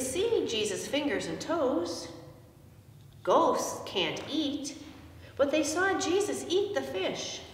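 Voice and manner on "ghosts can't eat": ordinary delivery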